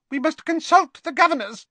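very high squeaky professor; squeaky little voice. Acted. Flitwick urging the staff to consult governors, squeaky. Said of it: "in his squeaky little voice".

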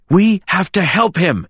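high, insistent appeal; urgent plea. A narrator's reading. James pleads with the others for Richard, urgent. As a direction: beg them to act. Pleading to save dying friend.

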